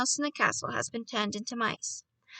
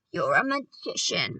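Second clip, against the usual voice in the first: mild mock shout; 5 semitones lower than usual. A narrator's reading. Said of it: "my grandmother shouted".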